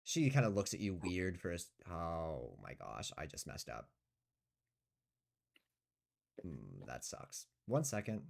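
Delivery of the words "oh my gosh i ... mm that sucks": dismayed mutter. Real, not acted. GM messes up his cards.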